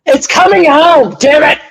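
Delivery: loud, high, drawn-out slogan; defiant shout. Real, not acted. Fan insisting to laughing co-hosts, defiant shout. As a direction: holler it slowly as the last word.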